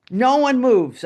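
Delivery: loud, stern order to the class; firm command. Retold or imitated. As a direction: tell the class to freeze. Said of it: "I said in the class".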